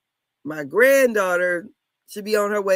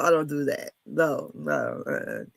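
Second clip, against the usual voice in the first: usual speaking voice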